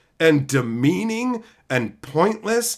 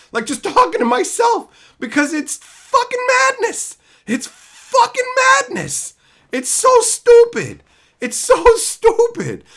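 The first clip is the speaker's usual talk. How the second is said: exasperated; 5 dB louder than usual. Real, not acted. Exasperated rant peak.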